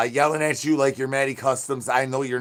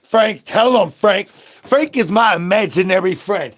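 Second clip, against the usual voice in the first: loud excited call; 5 semitones higher, 6 dB louder than usual. Acted. Loud high calling Frank.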